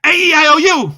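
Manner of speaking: high, loud vowels; loud, high, jolting vowels; loud and fast. Shown as a demonstration. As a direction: blast the vowels out. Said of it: "Loud and fast".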